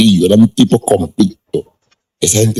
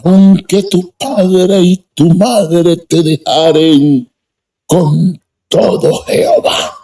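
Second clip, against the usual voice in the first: preacher quoting scripture; 10 semitones higher, slower than usual. Retold or imitated. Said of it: "Y dijo".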